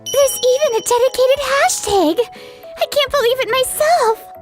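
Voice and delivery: high-pitched excited gushing; giddy disbelief. Acted. Staffer tells colleague about the galette's hashtag, excited. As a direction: gush over news too good to believe. Thrilled at product's viral success.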